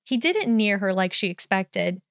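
usual speaking voice

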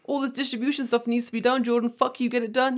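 high, mocking boss voice; hammed-up boss piling on orders; mimicked demanding boss. Mimicking bosses piling on work.

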